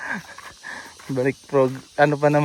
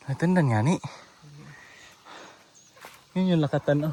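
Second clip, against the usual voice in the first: normal talking voice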